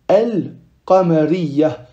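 slow al-qamariyya, lam clearly sounded; lam pronounced. Shown as a demonstration. Teacher demonstrating a lunar letter, lam pronounced. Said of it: "on prononce le lam".

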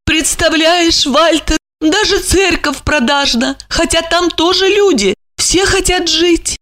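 high female voice; lamenting lady. A narrator's reading. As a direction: sigh over the world's greed. Olga voiced by narrator.

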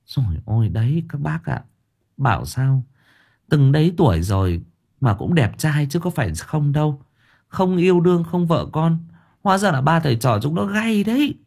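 put-on gossiping old woman. A narrator's reading. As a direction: gossip to the other aunties. (giọng bà tám, cao)

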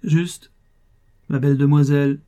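put-on old neighbour showing off his banknote, triumphant. A narrator's reading. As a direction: crow it in triumph. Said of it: "d'une voix triomphante".

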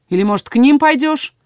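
sharp angry question. A narrator's reading. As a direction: snap the question angrily. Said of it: "спросил со злостью".

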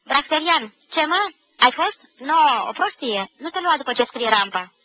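loud, high, incredulous comeback; shouted retort. A narrator's reading. Schoolboy answering a classmate across the din, shouted retort. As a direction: yell back in disbelief. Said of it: "își strigau".

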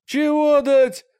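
offended. Acted. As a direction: act insulted by the word. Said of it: "переспросил Мрак оскорблённо".

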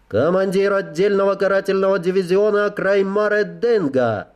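strange clicking voice, rapped out like a report; chittering insect creature. Acted. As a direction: snap it out in a clicking, rapping voice. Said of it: "странным щёлкающим голосом".

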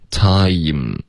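slow "time" with a full ee before m; full glide. Shown as a demonstration. Teacher showing when lips close, full slow glide. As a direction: let "time" slide ah to ee, lips closing last. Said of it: "你可以听到一个饱满的一".

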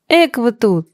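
ordinary delivery, nothing marked

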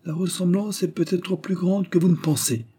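high-pitched, smiling insinuation; sly, ambiguous high voice; ambiguous smiling riposte. A narrator's reading. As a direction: retort with a veiled smile. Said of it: "riposta Serganov avec un sourire ambigu".

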